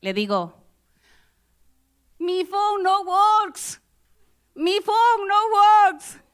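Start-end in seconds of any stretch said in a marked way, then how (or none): 2.2-6.2 high, very slow, loud broken-English plea; desperate Spanish-accented broken English. Retold or imitated. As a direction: spell out "Mi phone no works" slowly, loud and high, like a lost foreigner. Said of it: "en mi very good English".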